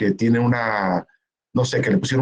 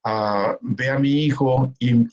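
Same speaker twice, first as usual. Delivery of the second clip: usual speaking voice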